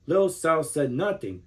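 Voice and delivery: ordinary delivery, nothing marked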